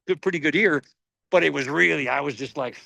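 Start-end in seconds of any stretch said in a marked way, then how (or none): none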